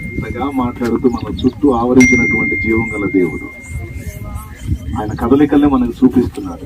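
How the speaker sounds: loud fiery altar-call preaching; loud, rousing pulpit preaching; full-force preaching. Acted. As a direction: thunder the call to repentance.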